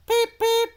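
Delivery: very high, loud piping peep; high whistle peep. Acted. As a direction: whistle like a small engine. Edward's whistle.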